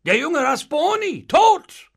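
shocked cry, high and loud; startled. A narrator's reading. Innkeeper hearing of a guest's death, startled. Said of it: "erschrocken auf".

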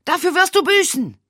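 high, loud furious roar; bellowing. Acted. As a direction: bellow it at the man behind the door. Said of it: "brüllte Edgar".

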